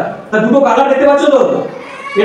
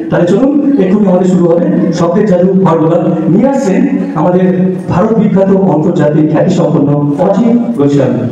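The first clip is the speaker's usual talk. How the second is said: announcer; 4 semitones lower than usual. Acted. Announcing next performer.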